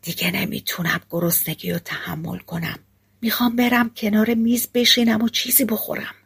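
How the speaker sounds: soft whisper, close and secret; whispering in his ear. Acted. Hungry soldier whispering to the hunter in hiding. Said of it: "زیر گوش شکارچی گفت".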